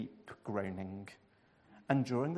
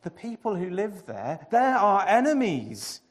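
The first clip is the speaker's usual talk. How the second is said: groaning; 5 semitones higher, 9 dB louder than usual. A narrator's reading. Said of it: "he groaned".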